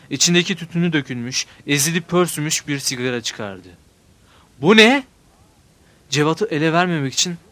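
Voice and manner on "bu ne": sharp higher "what is this"; accusing surprised question. A narrator's reading. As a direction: catch the child out. Mother finds cigarette.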